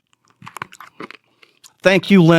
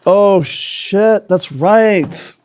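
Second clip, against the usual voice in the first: higher, startled oh shit; remembering something mid-set; sudden realization; 5 semitones higher, much slower than usual. Real, not acted. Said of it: "remembering a list item".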